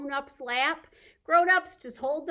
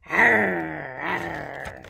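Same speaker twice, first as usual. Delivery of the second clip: playful mock bear; bear growl; 8 semitones lower, 7 dB louder than usual. Shown as a demonstration. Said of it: "Can you sound like a bear?".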